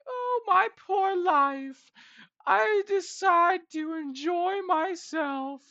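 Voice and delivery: mocking whiny impression. Mocking his absent co-host as a whiny crybaby, high and slow. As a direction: whine it out like a pitiful crybaby. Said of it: "He's like".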